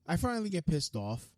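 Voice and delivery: usual speaking voice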